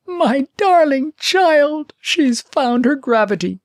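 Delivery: high, slow, loud cry of joy; delighted scream. Acted. Old nurse to the princess, screaming with delight. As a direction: shriek it out as the overjoyed old nurse. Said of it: "her, screaming".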